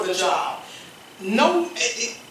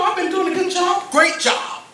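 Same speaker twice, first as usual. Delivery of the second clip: bold declaration; 9 semitones higher, 4 dB louder than usual. Retold or imitated. Bold claim to boss.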